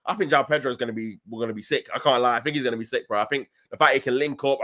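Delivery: ordinary delivery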